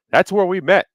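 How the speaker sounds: usual speaking voice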